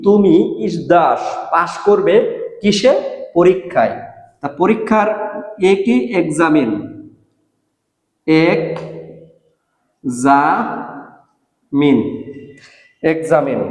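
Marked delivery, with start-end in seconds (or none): 6.2-13.6 এক্সামিন drawn out slowly, ek-za-min; এক্সামিন spoken slowly like a teacher; slow model pronunciation. Shown as a demonstration. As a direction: stretch এক্সামিন with a z. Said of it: "এক্সামিন মানে পরীক্ষা".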